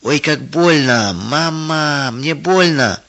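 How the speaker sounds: slow, high wailing complaint; crying in pain.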